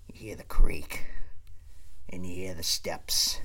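low, half-whispered suspense; suspenseful hush. Retold or imitated. Comedian builds dread over approaching footsteps, suspenseful hush. Suspenseful telling of creak and steps.

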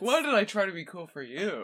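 high, laughing life-lesson line; laughing motto. Pretend. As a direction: read it like a cheesy wall sign, laughing. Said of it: "That's like a life lesson".

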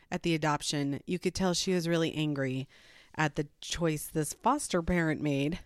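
usual speaking voice

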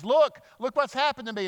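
high, loud, excited call; eager announcement. Retold or imitated. Healed man tells his friends, excited. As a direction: burst out with good news. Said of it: "Can't wait to tell all of his friends".

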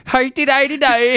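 high, loud, drawn-out nonsense cry; mock your friend's goofy foreign-sounding character; mimicked adventurer voice. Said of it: "threw me off with the hi-de-di-di-di".

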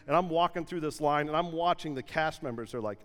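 usual speaking voice

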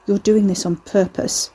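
usual speaking voice

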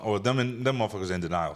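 ordinary talk

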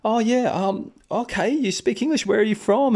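pitched well up, lightly voiced young woman; light impression of the girl asking; girl's voice. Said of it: "She says".

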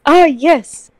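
put-on parent presenting a child, drawn out; pretend proud parent. Faking a family at Chuck E. Cheese, pretend parent voice. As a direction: introduce the fake son with mock importance. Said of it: "trying to pretend that we're a family".

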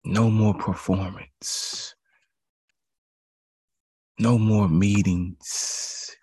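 each line slow and weighty, hitting NO; deliberate, heavy pulpit cadence; slow emphatic list. Real, not acted. Listing what must stop.

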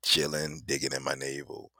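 friend's lazy answer. Retold or imitated. Friend answering what he got, low lazy imitation. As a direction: imitate a friend idly chilling. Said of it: "And you might be like".

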